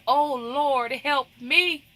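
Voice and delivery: impassioned appeal for help; crying out to God. Acted. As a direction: cry out OH and ME as an acted plea. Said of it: "Let's cry out to God like she did".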